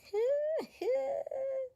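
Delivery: shrieking burst of laughter, way up high; can't hold it in, squeal with laughter; helpless high-pitched cackle. Real, not acted. Cracking up at the story.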